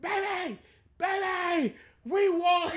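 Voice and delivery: high, calling out to his wife; imitating friend calling his wife about the win; excited calling out. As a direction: shout the news across the house. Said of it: "calling his wife's name out".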